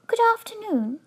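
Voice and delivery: high, clear little bear; a polite small creature, high-pitched. A narrator's reading. Bear greeting the couple politely, in a small clear voice. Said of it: "it said in a small, clear voice".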